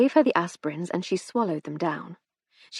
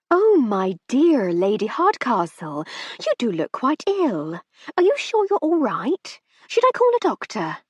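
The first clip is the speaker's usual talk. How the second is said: higher, slower, fussing society lady; hostess feigning concern; 5 semitones higher, 4 dB louder than usual. A narrator's reading. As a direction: lay the false concern on thick.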